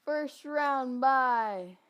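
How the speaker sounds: slow, loud and drawn out; excited. Real, not acted. Celebrating playoff bye.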